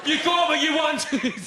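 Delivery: put-on high, strained drunken shouting; steaming drunk, wailing accusations; drunken raging yell. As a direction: yell at your ex in the street, very high and drawn out. Acts out steaming drunk man after vote.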